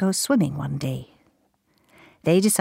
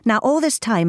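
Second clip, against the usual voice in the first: ordinary delivery, nothing marked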